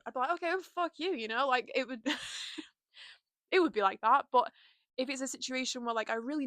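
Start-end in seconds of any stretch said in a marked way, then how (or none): 0.3-1.1 higher-pitched mock comeback; defiant retort. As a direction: brush them off defiantly, acted. Acts out standing up to stranger.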